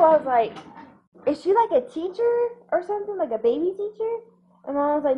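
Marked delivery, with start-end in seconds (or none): 1.3-4.2 high questioning voice. Retold or imitated. Quoting her own question to mom.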